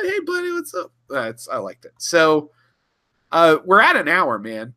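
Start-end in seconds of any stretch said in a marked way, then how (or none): 0.0-0.9 very high, falsetto innocent Sulu. Retold or imitated. Retelling Sulu's innocent greeting, squeaky high. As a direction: play dumb in a squeaky voice. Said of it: "It's, it's".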